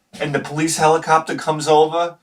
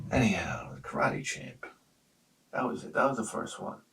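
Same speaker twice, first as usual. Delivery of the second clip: whisper; 4 semitones lower, 11 dB quieter than usual. Real, not acted. After story, hushed return.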